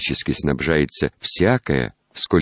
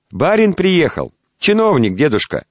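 loud high shout into a deaf ear; 12 semitones higher, 6 dB louder than usual. Acted. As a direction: call out loudly to a deaf old man. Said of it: "кричала меж тем Татьяна".

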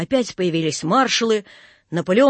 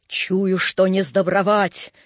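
ordinary delivery

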